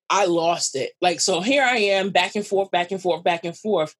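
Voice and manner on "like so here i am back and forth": ordinary talk, nothing marked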